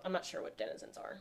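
ordinary talk

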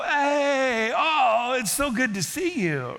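beaming hello to a dear friend; joyful greeting. Retold or imitated. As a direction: greet an old friend with glee.

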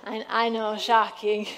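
ordinary talk, nothing marked

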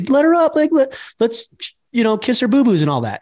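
high-pitched worried mother imitation; mock a mom rushing in to rescue; fussing mom.

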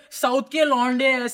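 slow, lightly put-on North Indian accent mocking trolls. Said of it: "They be like".

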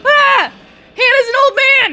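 loud high shriek; real startled scream. Real, not acted. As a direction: scream in shock. Shrieking at scary reveal.

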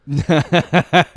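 high, loud burst of laughter. Real, not acted. Host laughing at co-host's dog joke, loud high laugh.

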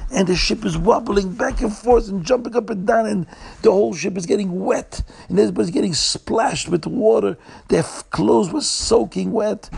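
high-pitched, breathless-paced storm narration; agitated storytelling. A narrator's reading. Storyteller describes the ship tossed and soaked, agitated.

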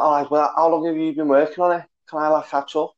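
hopeful lad in put-on Scouse; Scouse accent. Latecomer asking whether he can catch up, in Scouse accent. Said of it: "that was my best scouse accent".

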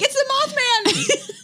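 high, slow, laughing mock panic; mock terror. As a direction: squeal it like a horror victim. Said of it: "I'm like".

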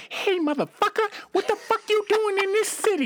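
high-pitched, drawn-out Harlem street voice; 1975 Harlem accent. Acted. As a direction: do a hammed-up seventies Harlem accent. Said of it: "I would do that accent for you".

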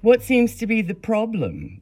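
a bored desk clerk's high, loud question. A narrator's reading. Desk clerk to the teens, loud and offhand. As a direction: toss off the question like a disinterested clerk. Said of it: "the woman asked, not appearing to be terribly interested".

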